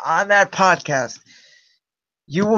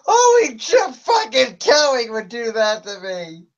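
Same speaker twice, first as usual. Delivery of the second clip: mock outrage; 6 semitones higher than usual. Mock outrage at old trade.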